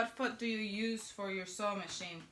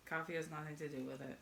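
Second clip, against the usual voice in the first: deadpan aside; 5 semitones lower, 11 dB quieter, faster than usual. Real, not acted. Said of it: "I'm kidding".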